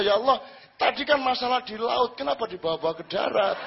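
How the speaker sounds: indignant protest. Retold or imitated. Fisherman protests to God.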